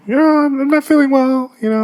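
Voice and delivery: very high, squeaky nervous excuse; feebly feigned illness. Retold or imitated. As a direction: squeak out a hammed-up excuse to grandma. Feigning illness to his grandmother.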